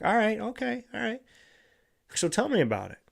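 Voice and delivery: high, soft okay okay; gentle reassurance. Retold or imitated. Answering a tired partner, soft and reassuring. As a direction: coax them to open up softly. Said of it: "you go over to them and say".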